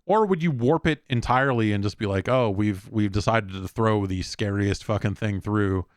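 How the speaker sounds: ordinary talk, nothing marked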